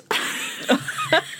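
squealing breathy laugh; high burst of laughter. Real, not acted. Friend laughing at the interviewee's comeback. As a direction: cracks up at the punchline. Cracking up at the quote.

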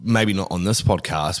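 ordinary talk

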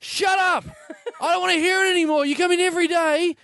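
high-pitched exasperated telling-off; mock angry rant. Fed-up housemate snapping at the daily weirdo, mock angry and high. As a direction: let it all out at the pest, hammed up and high. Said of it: "He was in a bad mood".

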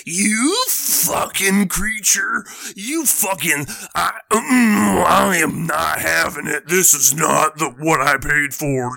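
slow, loud, strained raging cry; enraged rant. Acted. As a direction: play a crazed man losing it at a pixie. Crazed man raging at pixie.

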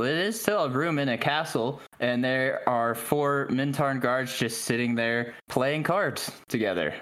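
ordinary talk, nothing marked

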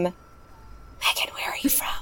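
hushed whisper, no voice at all; whispered aside. Real, not acted. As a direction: whisper it like a stage prompt. Whispered aside mid-bit to Megan.

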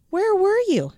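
put-on high, loud excited children; excited kids. A narrator's reading. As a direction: kids bursting to know. Kids cry out to teacher.

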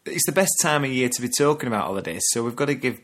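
ordinary talk, nothing marked

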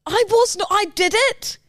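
high-pitched, defensive protest; indignant denial. Real, not acted. Woman rejects her partner's name-calling, indignant denial. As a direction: push back hard against the accusation. Heated denial of being called names.

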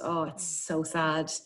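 usual speaking voice

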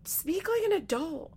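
high-pitched, exasperated plea; exasperated rebuke. Retold or imitated. Host scolding the baby-talker after imitating her, exasperated.

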